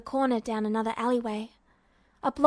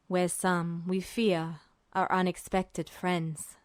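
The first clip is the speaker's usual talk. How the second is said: lower, softer, slow man's voice; cool, unreadable older officer; a calm, low voice from the end of the table; Mustang's voice; 5 semitones lower, 4 dB quieter, slower than usual. A narrator's reading.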